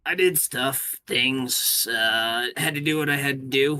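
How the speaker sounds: loud, lightly voiced evasive creature; evasive Yom. Yom dodging what he did, loud evasive answer. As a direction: brush the question off loudly.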